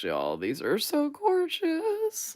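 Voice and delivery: high, slow squeal of delight; drawn-out high gushing; excited gush. Real, not acted. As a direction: swoon over the stickers. Gushing over winter sticker scenes.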